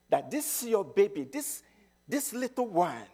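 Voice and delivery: ordinary talk, nothing marked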